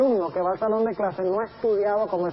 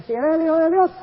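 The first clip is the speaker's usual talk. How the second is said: devil's taunt; 6 semitones higher, 5 dB louder than usual. Retold or imitated. Devil tempts Jesus at temple.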